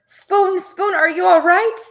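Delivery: very high anxious call; worried calling. A narrator's reading. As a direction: call out to a hurt friend.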